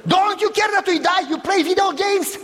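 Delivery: high, alarmed disbelief; panicked protest. Retold or imitated. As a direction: cry out in alarm at the kids. Friend panicking at the kids.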